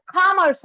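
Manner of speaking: "commerce" with an open, long first vowel, "KAAH-murs"; third variant pronunciation. Shown as a demonstration. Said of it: "pronounced by Indians in three different ways".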